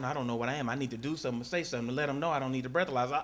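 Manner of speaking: usual speaking voice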